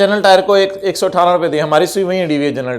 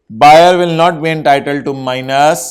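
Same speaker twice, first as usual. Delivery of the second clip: very slow, louder dictation of a rule; 6 dB louder, much slower than usual. Acted. Teacher dictating to students taking notes, loud and very slow. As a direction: spell the rule out word by word.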